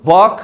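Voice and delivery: वॉक said plain with silent l; teacher answers own question with वॉक; वॉक without l. Shown as a demonstration. वॉक, एल बिना. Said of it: "ये क्या हुआ".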